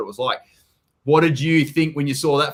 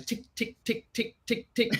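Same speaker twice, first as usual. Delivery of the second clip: soft clicky tick tick sound, pitched up and quieter; imitated dryer ticking; 8 semitones higher, 8 dB quieter, slower than usual. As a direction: tap out the dryer noise with your tongue.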